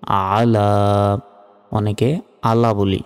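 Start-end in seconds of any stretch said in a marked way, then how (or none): 0.1-1.2 আলা drawn out very slowly; slow chanted আলা; slow recitation. Shown as a demonstration. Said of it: "এই যে দেখুন".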